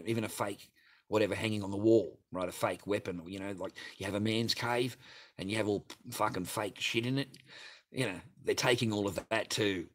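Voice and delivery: usual speaking voice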